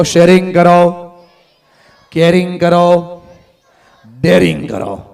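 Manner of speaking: loud, slow chant of sharing કરો; said with force. Shown as a demonstration. Speaker leads the audience, loud and slow. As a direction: call each word out slowly and loudly. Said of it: "જોશથી બોલો".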